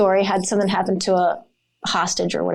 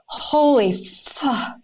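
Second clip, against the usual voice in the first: slow stunned disbelief; 3 semitones higher, much slower than usual. Retold or imitated. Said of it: "at the time you're thinking".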